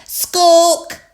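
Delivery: loud, high bird squawk; parrot squawk. A narrator's reading. Polly answers at the supermarket with a loud squawk. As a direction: open the beak and squawk. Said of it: "Polly Parrot opened her beak".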